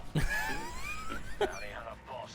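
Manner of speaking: squeaky high-pitched laugh; high giggle. Real, not acted. Streamer teasing a moderator, high giggle.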